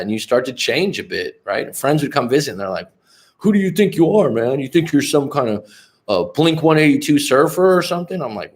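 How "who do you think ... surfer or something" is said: higher, incredulous ribbing of a friend; who-do-you-think-you-are heckle, acted out; mocking challenge. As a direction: imitate a pal calling someone out. Visiting friends challenge him, retold.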